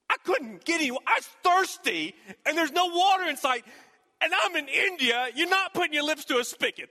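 high, loud, rising panic as he tells it; panicked retelling. Retold or imitated. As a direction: pastor reliving his panic over no water, pitched high and loud. Panicking about thirst in India.

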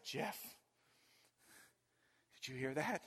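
hushed scared whisper. Retold or imitated. Hiding from a noise, whispering to Jeff in fear. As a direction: whisper it so nothing in the woods hears.

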